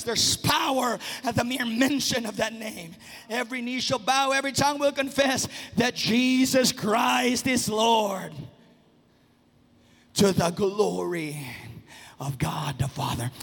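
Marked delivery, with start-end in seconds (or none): none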